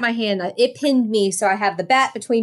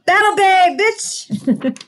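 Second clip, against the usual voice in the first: battle cry; 9 semitones higher, much slower than usual. Acted. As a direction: shout it like a warrior. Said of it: "This is when my Xena cry comes out".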